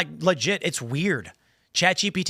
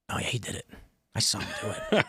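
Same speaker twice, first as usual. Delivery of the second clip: soft mock-confidential tattling; hushed informant; 5 semitones lower, 6 dB quieter than usual. Host playing Gemini telling on ChatGPT, hushed. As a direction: drop the volume like a secret witness.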